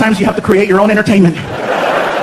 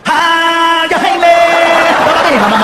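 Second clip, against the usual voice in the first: high, drawn-out war cry; mock battle yell of a protective mother; mama lion charging; 8 semitones higher, slower than usual. Mom comes running to protect son, mock war cry.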